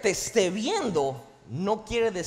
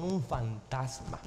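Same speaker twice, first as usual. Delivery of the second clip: hushed fearful disciples; 6 semitones lower, 6 dB quieter, slower than usual. Retold or imitated. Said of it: "ellos dijeron".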